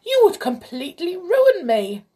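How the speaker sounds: high, loud squealed protest; angry squeal. A narrator's reading. King protesting to the flute player about his gold, squealed in anger. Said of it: "squealed the king in anger".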